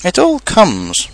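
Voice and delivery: high, slow, peeved complaint; cross. A narrator's reading. Pooh blaming Rabbit for being stuck, cross. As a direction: draw it out crossly, laying blame. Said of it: "said Pooh crossly".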